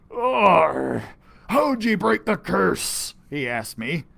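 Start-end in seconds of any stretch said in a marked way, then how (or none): 0.1-3.1 lightly voiced gruff pirate, slow; a hint of an old sea captain asking. Captain Stinkybeard asks the boy, lightly gruff. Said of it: "Captain Stinkybeard".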